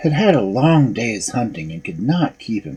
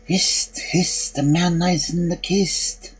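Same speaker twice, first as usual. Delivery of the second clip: breathy, slow whispered call; eerie whisper; 6 semitones higher, 4 dB quieter, slower than usual. A narrator's reading. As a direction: hiss it like a ghostly voice. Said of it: "called out a second time".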